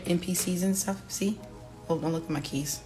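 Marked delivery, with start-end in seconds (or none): none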